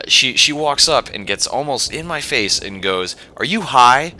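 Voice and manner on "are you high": mock accusing question, higher and louder, rising at the end. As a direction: imitate an indignant driver demanding an answer. Accuser gets in her face.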